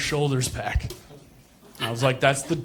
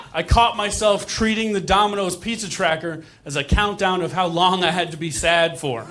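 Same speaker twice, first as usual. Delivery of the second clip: ordinary talk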